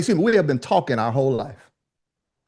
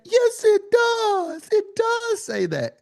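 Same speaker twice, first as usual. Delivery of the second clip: put-on much higher, slow, dragged-out kid voice of a stubborn three-year-old; imitating his daughter; much slower than usual. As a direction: play the insistent little girl, stretching each word.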